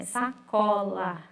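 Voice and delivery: "Sacola" slow, sa-co-la; slow classroom "Sacola"; "Sacola" split for children; syllables shown. Shown as a demonstration. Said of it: "Quais palavras eu posso formar".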